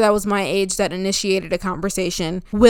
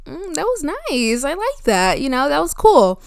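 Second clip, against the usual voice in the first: contented; 6 semitones higher than usual. Retold or imitated. Content inner thought after the chat.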